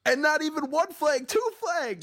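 very high-pitched outraged disbelief; incredulous exclamation. Real, not acted. Guest jumping in about the flags, incredulous. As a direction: squeal it, pitch way up.

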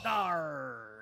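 pirate voice. Pretend. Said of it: "The pirate voice means".

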